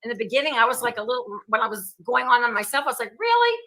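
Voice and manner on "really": high, disbelieving really; can't believe it's me; incredulous really. Retold or imitated. Host recalling seeing herself, incredulous. Incredulous reaction to own webcasts.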